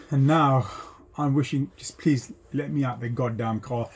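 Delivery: normal talking voice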